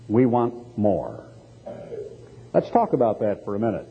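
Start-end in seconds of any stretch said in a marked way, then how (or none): none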